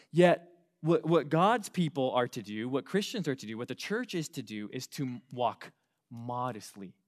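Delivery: ordinary talk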